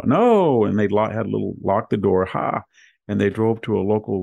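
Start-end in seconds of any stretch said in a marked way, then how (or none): 0.0-0.6 sharp, loud, high no; retold shout. Teen friends refusing to let him out, loud mock shout. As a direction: shut him down with a yelled no.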